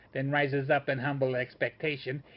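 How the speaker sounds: ordinary delivery, nothing marked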